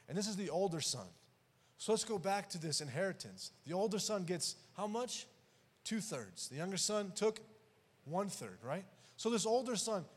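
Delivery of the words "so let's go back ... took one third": ordinary delivery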